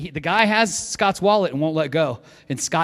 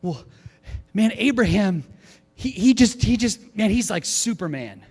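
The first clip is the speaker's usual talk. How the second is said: excited retelling; 4 semitones higher, 5 dB louder than usual. Retold or imitated. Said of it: "she's like".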